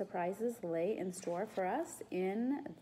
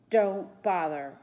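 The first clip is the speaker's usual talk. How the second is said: slow, louder villain warning; hoarse voice; 8 dB louder, slower than usual. A narrator's reading. Hidden man to trapped boys, a touch hoarse. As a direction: drag it out loudly, lightly voiced hoarse. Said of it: "a hoarse voice said".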